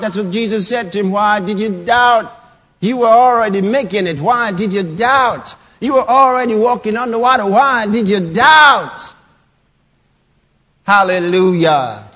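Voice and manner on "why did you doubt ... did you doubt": loud rising rebuke, ending in a shout; rebuking. Acted. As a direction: press the question harder each time. Voicing Jesus rebuking Peter.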